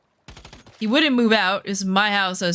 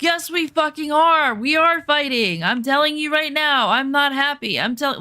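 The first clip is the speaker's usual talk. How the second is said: retold angry insistence, raised high; 6 semitones higher than usual. Woman retelling the fight with her boyfriend, angry. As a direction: argue back angrily, pitch up and pressing. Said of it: "And then I got angry".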